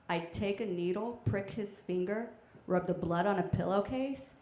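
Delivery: normal talking voice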